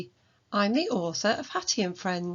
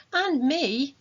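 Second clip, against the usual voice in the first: put-on high, louder little boy; eager child; 8 semitones higher, 5 dB louder than usual. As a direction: chime in like a keen child. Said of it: "said Toby".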